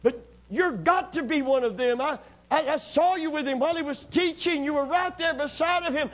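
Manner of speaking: pressing accuser. Acted. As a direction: push him to admit it. Bystanders pressing Peter.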